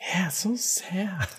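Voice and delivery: soft sad whisper, slow. Real, not acted. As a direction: breathe it out in a whisper. Sad news about the bunnies.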